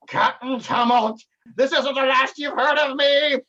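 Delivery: high, loud and slow, a hammed-up villain; villain's vow. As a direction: swear revenge like a cartoon baddie. Defeated villain vows revenge.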